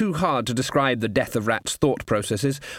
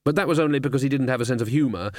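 ordinary talk

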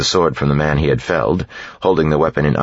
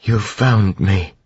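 tender; 6 semitones higher, much slower than usual. A narrator's reading. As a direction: savor it gently. Vin tender, smiling up.

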